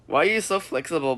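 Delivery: ordinary talk, nothing marked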